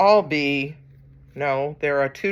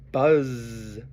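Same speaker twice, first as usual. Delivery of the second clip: long, drawn-out buzzing Z; bee buzz; 4 semitones lower than usual. Acted. Reader imitating a bee for children at story time, drawn-out buzz. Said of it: "He can sound like a bee".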